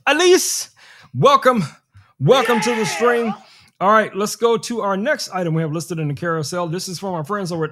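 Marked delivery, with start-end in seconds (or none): 0.1-3.3 higher, louder hello to a viewer; calling out a welcome. Real, not acted. Streamer greets Elise joining the stream: excited, louder call. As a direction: hail her like a friend walking in.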